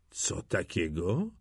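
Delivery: low, slow, soft disbelief; quiet stunned question; hushed astonishment. A narrator's reading. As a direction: ask slowly under your breath, amazed. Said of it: "zdziwił się duży Klaus".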